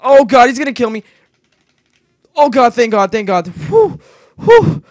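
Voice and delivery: loud, high panicked cries; panic, then breathe out relief. Real, not acted. Gamer chased by a mob, panicking loudly then relieved. Mob nearly kills him.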